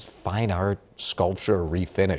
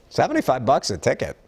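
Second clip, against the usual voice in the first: ordinary talk, nothing marked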